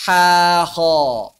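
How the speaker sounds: ordinary talk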